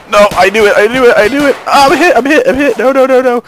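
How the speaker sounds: high, loud, rapid-fire panic; real frantic shrieking; panicked screaming. Real, not acted. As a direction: scream it as you get hit. Getting shot while escaping.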